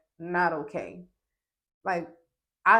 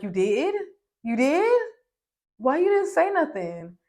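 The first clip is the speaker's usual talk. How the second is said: put-on girls in shock; 6 semitones higher than usual. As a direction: gasp it out like the girls did. Girls reacting in shock, quoted.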